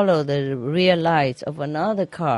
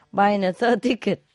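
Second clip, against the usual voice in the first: usual speaking voice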